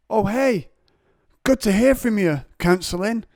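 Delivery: high, loud chirpy greeting; mock ecstatic hello; fake cheery. Shown as a demonstration. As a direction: sound way too happy on the phone. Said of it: "I could also be like".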